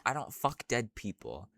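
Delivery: ordinary delivery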